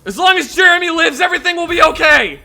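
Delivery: high, loud overwrought declaration; hammed-up devotion. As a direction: declare it like a melodrama hero. Over-the-top devotion to Jeremy.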